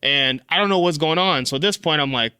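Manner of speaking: ordinary talk, nothing marked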